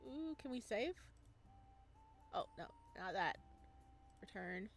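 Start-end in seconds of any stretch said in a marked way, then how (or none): none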